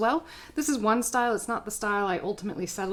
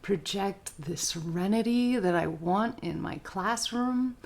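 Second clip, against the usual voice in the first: super calm; slower than usual. Shown as a demonstration. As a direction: project serenity to the class. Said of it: "I can be super calm".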